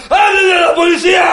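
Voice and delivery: high, loud shout for the police; imitating the man yelling; mock yell. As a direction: holler for the cops. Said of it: "y empieza a gritar".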